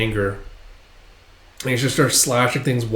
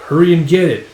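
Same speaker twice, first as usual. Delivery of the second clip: raised, firm command; louder, higher, held short of yelling; impatient order; 5 semitones higher, 7 dB louder than usual. Acted. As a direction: order someone off with irritation. Impatient order, first take.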